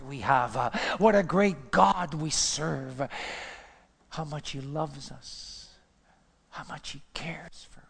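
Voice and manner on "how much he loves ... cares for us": slow, soft, then whispered; hushed awe; fading to whisper. Acted. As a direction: drop it to a whisper. Preaching drops to hush.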